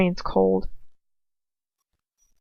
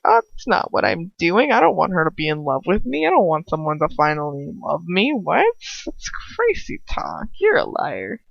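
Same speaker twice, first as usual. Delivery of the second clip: mock denial; 3 semitones lower than usual. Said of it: "Not like".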